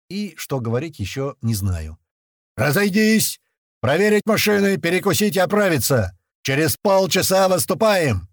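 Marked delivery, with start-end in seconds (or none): 2.6-8.3 loud, high military shout; barked orders. A narrator's reading. Grach dismisses fighters before they move out, barked orders. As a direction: bark out orders to the whole formation. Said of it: "рявкнул он".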